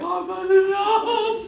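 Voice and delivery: hammed-up sobbing wail, high, loud and slow; mock bawling wail. Wife bawling to her husband on the phone, hammed-up wail. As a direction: wail it like a crybaby, half sung. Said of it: "bawled my eyes out".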